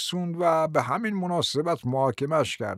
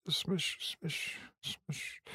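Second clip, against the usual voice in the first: fading from soft voice into a whisper; trailing off; 16 dB quieter than usual. Acted. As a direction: let the words die away while searching memory. Old man forgets a name.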